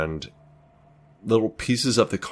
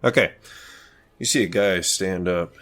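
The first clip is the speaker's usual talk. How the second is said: ordinary talk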